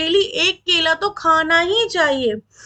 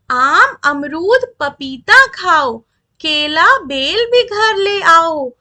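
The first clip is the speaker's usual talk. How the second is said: slow, louder rhythmic reading of verse; poem recitation; 4 dB louder, slower than usual. Acted. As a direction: recite the fruit list slowly and clearly to the class. (धीमी, ज़ोरदार लय में कविता पाठ)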